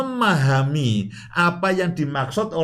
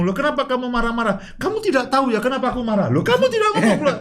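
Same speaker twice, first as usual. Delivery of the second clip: quarrelling couple; 7 semitones higher, much faster than usual. Acted. Acted spouse quarrel exchange.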